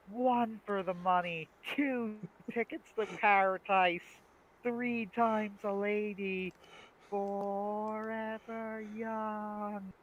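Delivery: high, very slow announcer call; drawn-out commentator voice. Retold or imitated. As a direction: stretch every phrase out, holding the last note long. Quotes sketch commentator, drawn out.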